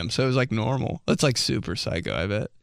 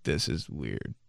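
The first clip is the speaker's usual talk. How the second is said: lower, softer awkward mumble; 11 semitones lower, 9 dB quieter, slower than usual. Retold or imitated. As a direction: mutter it under your breath, embarrassed. Awkward kid being yelled at.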